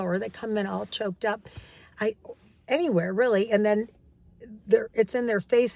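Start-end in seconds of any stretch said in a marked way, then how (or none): none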